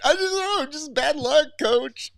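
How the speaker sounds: mock whiny student. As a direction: play the guilty student pleading innocence. Mocking a student's excuse.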